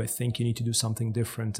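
hushed, like a nudge from inside; inner voice. Retold or imitated. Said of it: "a sound inside of you telling you".

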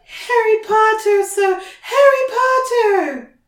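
high, loud squealing house-elf; Dobby. A narrator's reading. House-elf greets Harry in the kitchen, squealing. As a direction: squeal it out in delight, stretching the name. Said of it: "squealed the voice".

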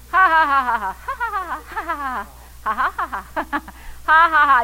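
long run of deliberate laughter; laughing. Shown as a demonstration. Believer laughing at the devil's lies, defiant laughter. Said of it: "A believer starts laughing".

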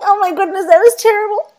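high, loud, tearful laughing words; teary laugh. Real, not acted. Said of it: "I'm so bloody soft".

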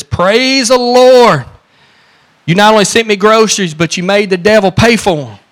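overjoyed, clapping and jumping; jubilant shouting. Retold or imitated. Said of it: "jumping up and down and clapping her hands".

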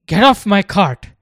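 put-on furious loud shout at the boys; angry yell. A narrator's reading. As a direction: bark him off the cart. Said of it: "yelled the cart driver".

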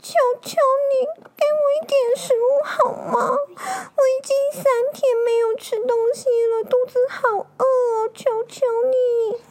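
very high, tearful little-dog voice; begging puppy. Acted. Hungry dog begs the old man for food, high tearful pleading. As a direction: whimper and plead like a starving pup. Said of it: "他求求老公公说".

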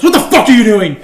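imitating a dad's very loud, high outburst; outraged dad. Friend imagining dad's shock at mom, mock-furious shout.

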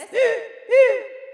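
loud hitching sob gasps, mock crying; imitated crying gasps. Mother imitating her child crying too hard to breathe, exaggerated gasping sobs. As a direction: gasp hard as if you can't catch your breath. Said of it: "crying, the kind of like this".

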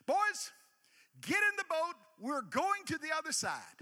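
put-on high and loud, summoning the boys; calling out. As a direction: call the crew to the boat. Jesus calling to the disciples.

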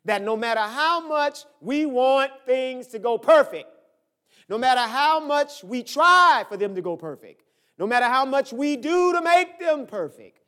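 loud, rising preacher's cadence; full-force preaching. Real, not acted. Pastor tells congregation nothing goes perfect, preaching at full force. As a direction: build each repeated phrase, loud and insistent.

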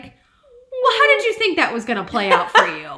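high, laughing, mockingly loud; sarcastic laughing. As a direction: toss it off with a laughing sneer. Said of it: "I'm kinda like".

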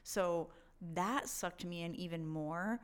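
ordinary talk, nothing marked

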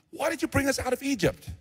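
high-pitched plaintive protest; whining complaint. Acted. Frightened Israelites blaming Moses, whining. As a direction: act out a high, aggrieved whine.

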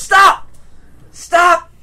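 high frantic shout; panicked yell. Acted. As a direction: yell to stop them. NPC yelling at intruders.

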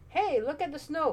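put-on high, excited children's call; children crying out at snow. A narrator's reading. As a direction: shout with delight at the snow. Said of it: "cried the children".

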